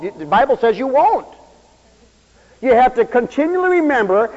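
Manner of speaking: normal talking voice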